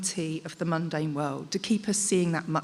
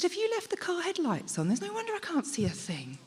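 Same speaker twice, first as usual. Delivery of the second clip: high, retold puzzled question to the husband; asking her husband if the headlights are on, pitched high; 11 semitones higher, 3 dB quieter than usual. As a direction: ask much higher, as if baffled by the light. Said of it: "And I said".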